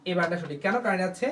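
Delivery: normal talking voice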